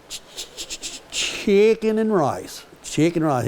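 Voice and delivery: breathy ch- stammer, then high, slow chicken and rice; child's stutter into a high answer. Retold or imitated. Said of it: "he kinda stutters a little bit".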